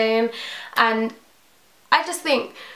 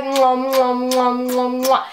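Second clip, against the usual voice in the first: between kisses; 2 semitones higher, much slower than usual. Real, not acted. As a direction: blow kisses to the camera. Blowing kisses at video end.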